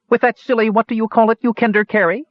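high-pitched scoffing elf; incredulous elf. A narrator's reading. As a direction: scoff in disbelief, pitched well up. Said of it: "a fair eyebrow arched incredulously".

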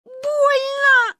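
very high, loud cry of pain; howling. A narrator's reading. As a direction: wail it out long. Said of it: "выла Маня".

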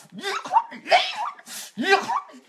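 very high, squealed curse; hateful squeal in short bursts; high-pitched screech. Real, not acted. Cursing communists in a mocking rant.

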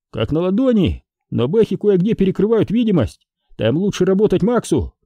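high, eager soldier's report; rattling off. A narrator's reading. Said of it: "затараторил Ежов". (тараторящий доклад высоким голосом)

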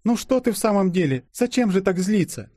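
high, fussing wife's voice; calm your husband in a high little voice; soothing wife. A narrator's reading. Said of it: "кинулась успокаивать благоверного Катенька". (успокаивающая жена, высокий голос)

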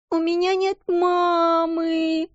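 put-on high, slow childish whine; whimpering child. A narrator's reading. Motherless little girl whining to her friend. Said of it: "захныкала она".

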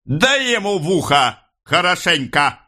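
high-pitched command to strike; sharp order. A narrator's reading. Police chief orders a beating. (повелительно, высоким голосом)

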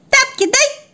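put-on very high toddler squeak; demanding baby puppy. (требовательный детский писк)

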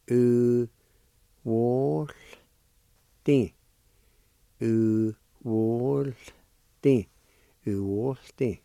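"U-wol-ti" stretched out slowly, syllable by syllable; slow pronunciation demo. Shown as a demonstration. Teacher modelling the word for learners, slowly. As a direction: linger on each part of "U-wol-ti". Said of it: "like this".

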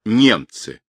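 ordinary delivery, nothing marked

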